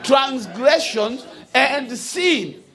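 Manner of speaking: rising, slow, ringing preacher's cadence; stretched, declaiming sermon voice; full preaching. Acted. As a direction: preach it to the congregation with weight. Preaches from the pulpit.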